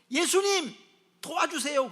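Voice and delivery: loud, high plea to Jesus; acted cry for help. Dreamer calling Jesus for help in a nightmare, urgent acted cry. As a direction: call out for rescue.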